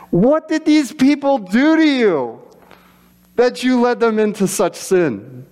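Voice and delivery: high, slow, exasperated question; exasperated Moses. Acted. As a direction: demand it with disbelief. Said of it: "Moses quoted again".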